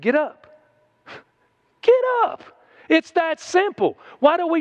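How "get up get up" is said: high laughing "Get up", pitched way up; emphatic command. Retold or imitated. Preacher voicing Jesus telling the man to rise, high and laughing. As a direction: cry it out with a laugh. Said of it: "Jesus said".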